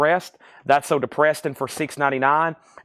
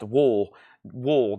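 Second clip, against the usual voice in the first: "Wool" for we'll, showing off a Southern accent; Southern "Wool", one rounded syllable, said on its own; 4 semitones lower, 3 dB quieter than usual. Shown as a demonstration. Auctioneer owning up to the accent, Southern "Wool" for we'll. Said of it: "That is my Southern accent at its best".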